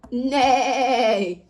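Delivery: neighing like a horse; whinny it out for the kids. Shown as a demonstration. Host asks the kids, then a playful horse neigh. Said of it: "What silly sound does a horse make?".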